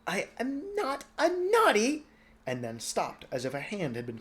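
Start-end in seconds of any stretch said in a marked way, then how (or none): 0.0-2.0 put-on high, slow whiny boy; childish protest. Twinkle protesting from afar, high slow whine. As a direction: wail it like a sulky child. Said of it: "Twinkle went".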